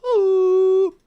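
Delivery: high, loud celebratory shout; excited cheer. Real, not acted. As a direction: cheer them on, thrilled. Cheering a team qualifying.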